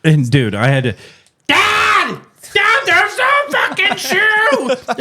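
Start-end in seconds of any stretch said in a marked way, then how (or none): 1.5-4.9 put-on high, far-off panicked kid's yell, drawn out slow; son yelling for dad. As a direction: wail it like a stranded kid calling for his dad.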